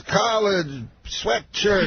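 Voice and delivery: very slow, high mimicry; impression that talks just in words; Bill Cosby. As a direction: drop each word separately, drawn out. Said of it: "He talks, like, just in words".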